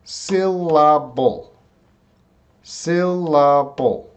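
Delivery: "Syllable" slowly as sil-laa-bull; "Syllable" sounded out with a long "la"; three drawn-out syllables. Shown as a demonstration. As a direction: stretch "Syllable" into three beats. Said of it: "Third vowel sound".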